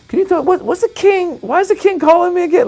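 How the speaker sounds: very high, nervous squeaky voice; panicky squeak. Retold or imitated. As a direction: voice cracking with nerves. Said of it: "I kept wanting to be like".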